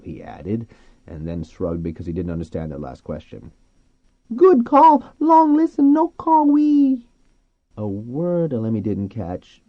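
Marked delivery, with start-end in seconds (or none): 4.3-7.1 very high, loud, drawn-out squeal; talking dolphin. A narrator's reading. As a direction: squeal each word out slowly like a dolphin. Dolphin Flo speaking.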